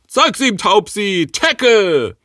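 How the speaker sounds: high, loud battle command; Pokémon trainer command. Pretend. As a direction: shout orders like a Pokémon trainer. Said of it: "und dann sagen".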